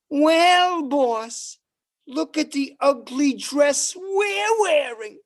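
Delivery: sarcastic reply to his boss; sassy comeback. Retold or imitated. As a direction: fire it back at the boss. Comedian sassing the boss back.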